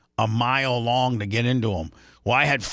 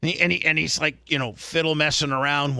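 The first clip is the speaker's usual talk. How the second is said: ordinary talk, nothing marked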